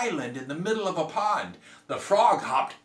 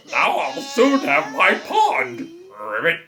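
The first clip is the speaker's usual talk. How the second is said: louder, slow gloating frog; gleeful frog; 4 semitones higher, 9 dB louder than usual. Acted. Frog gloating beside the crying girl, louder. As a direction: relish it like a smug frog.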